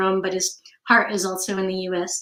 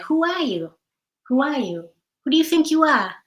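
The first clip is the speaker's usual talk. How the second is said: Massachusetts accent, pitched higher and playful; 5 semitones higher than usual. Shown as a demonstration. Riddle for viewers done in Massachusetts accent. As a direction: tease it out in a mock Massachusetts accent. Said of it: "if you're from Massachusetts".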